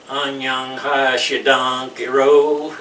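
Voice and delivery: put-on "Annyeong Hashidang Kiro" rolled out slow and loud in the Cholado accent; Cholado dialect greeting. Showing the girls the southern Cholado greeting, rolled out. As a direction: drawl "Annyeong Hashidang Kiro" out slowly and loudly, Cholado style. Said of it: "they roll it out and have a, a funny accent".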